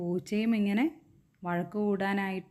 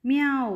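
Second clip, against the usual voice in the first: loud, high drawn-out meow; a loud, high cat's meow; 6 semitones higher, 6 dB louder than usual. Acted. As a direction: mew like a cat, loud and high. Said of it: "somebody said again".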